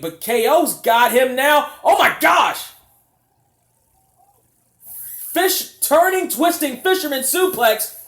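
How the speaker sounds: rising into a shout at the big move; excited play-by-play. Real, not acted. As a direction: call the spot like a hyped commentator. Big move called live.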